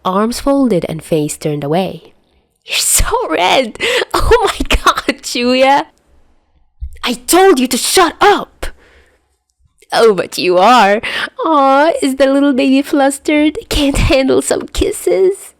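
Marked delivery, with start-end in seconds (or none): 2.6-5.9 teasing. Acted. Albatross teasing flustered Chuya. 7.0-8.7 angry yell. Acted. Chuya yelling back at teaser. 9.9-15.5 mock baby talk. Albatross mocking Chuya as baby.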